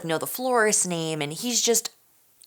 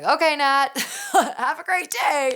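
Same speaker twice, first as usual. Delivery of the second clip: high laughing imitation of dad; dad brushing it off; 10 semitones higher, 3 dB louder than usual. Daughter imitating her dad ending the chat, laughing high. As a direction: mimic dad chuckling, amused and dismissive. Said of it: "He was like".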